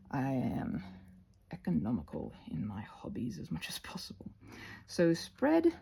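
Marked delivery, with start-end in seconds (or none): 0.1-4.3 lower, whispered admission; low whispered confession. Real, not acted. Crafter whispering about her budget hobbies. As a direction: hush it like a guilty secret.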